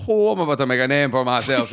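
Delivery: hammed-up slurred comic-book hero impression; Gambit. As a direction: put on a showy, mush-mouthed hero voice. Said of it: "this is my Gambit bit".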